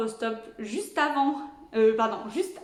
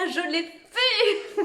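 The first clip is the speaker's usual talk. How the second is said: emotional laugh; 7 semitones higher, 4 dB louder, much slower than usual. Real, not acted. Overwhelmed by finishing treatment.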